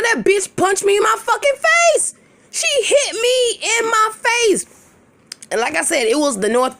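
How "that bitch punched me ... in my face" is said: very high-pitched, indignant disbelief; outraged retelling. Retold or imitated. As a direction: pitch it way up in outrage, drawing out the last words. Retelling being punched, outraged.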